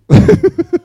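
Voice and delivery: loud, high burst of laughter; real laugh. Real, not acted. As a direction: laugh out loud at your own tease. Laughs after teasing the audience.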